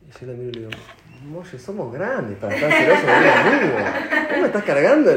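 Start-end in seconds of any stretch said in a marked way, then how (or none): 1.2-5.1 mock incredulity. Joking made-up retort to jealous friend.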